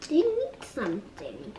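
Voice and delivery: ordinary talk, nothing marked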